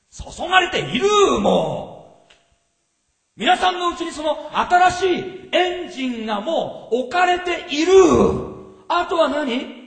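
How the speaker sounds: 高く大声で畳みかける; 会衆を揺さぶるように叫ぶ; 叫ぶような説教. Acted. Shouted preaching. (会衆に御霊の力を叫んで告げる)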